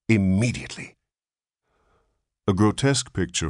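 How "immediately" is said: insistent. A narrator's reading. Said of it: "insisted the nurse".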